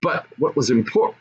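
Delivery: plain reading voice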